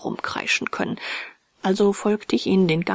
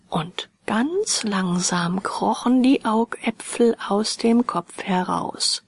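ordinary delivery, nothing marked

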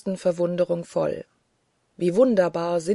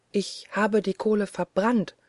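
ordinary delivery, nothing marked

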